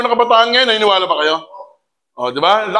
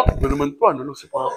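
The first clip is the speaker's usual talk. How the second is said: put-on dismissive child; 4 semitones higher, 6 dB quieter, faster than usual. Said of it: "sabi ng anak mo".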